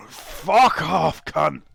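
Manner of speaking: groan, then a loud high-pitched curse; groaning angry yell. Acted. Hungover coachman swears at whoever is knocking, angry groaning yell.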